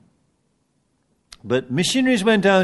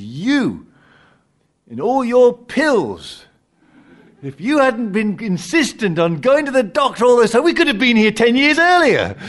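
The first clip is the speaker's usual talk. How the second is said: lightly voiced scolding wife, rising higher and louder; joke wife; 9 semitones higher, 4 dB louder than usual. Wife in heaven scolding her husband, mock nagging. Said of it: "the wife turns to the husband and says".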